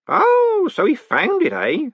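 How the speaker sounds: high, triumphant crow. A narrator's reading. Groat seizing on the golem's news, triumphant. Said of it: "said Groat triumphantly".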